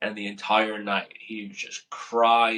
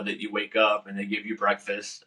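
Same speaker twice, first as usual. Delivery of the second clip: normal talking voice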